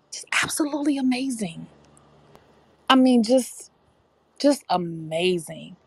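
breathless whispered awe; awed whisper. Real, not acted. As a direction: whisper it in real wonder. Awed about daughter at beach.

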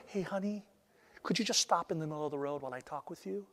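too-polite mock calm to a child in the road; softly chatting while danger looms; mildly casual. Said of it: "You wouldn't say".

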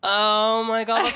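high, slow sarcastic gasp; mock amazement. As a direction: overdo the amazement at a bad joke. Mock delight at joke.

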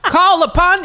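high, loud preacher-style prayer; loud church prayer. Retold or imitated. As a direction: cry it out like a church elder. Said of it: "It just was a loud situation".

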